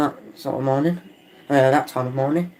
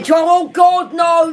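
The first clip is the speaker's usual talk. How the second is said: high, loud cry of alarm; panicked shout; 7 dB louder, much slower than usual. Real, not acted. Fan watching a chance against England, panicked shout. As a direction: yell as the shot nearly goes in. Near miss during live match.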